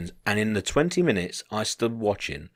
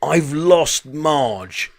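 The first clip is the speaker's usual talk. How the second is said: slow, drawn-out mock German wail; imitated German accent, stretched vowels; 6 semitones higher, 4 dB louder, much slower than usual. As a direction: call it out long and high in a German accent. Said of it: "it was in German accent".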